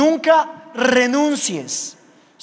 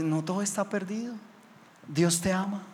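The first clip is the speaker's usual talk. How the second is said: gentle comfort; 8 dB quieter than usual. Retold or imitated. Comforting the mugger, hand on head.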